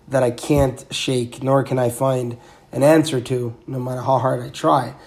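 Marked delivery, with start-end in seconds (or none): none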